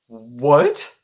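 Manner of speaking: loud, stunned "what?"; punchy mock-shocked question; retold disbelief. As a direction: blurt it as if floored by a gift. Acts out shock at the gift.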